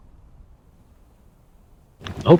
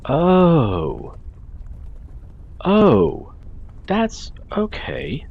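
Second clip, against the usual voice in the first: surprised; 9 semitones higher, much slower than usual. Real, not acted. Sudden discovery in game.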